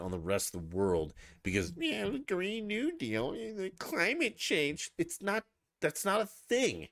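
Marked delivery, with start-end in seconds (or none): 1.8-6.9 very high, drawn-out mocking voice; mocking whine. Host ridiculing Green New Deal talk, high mocking whine.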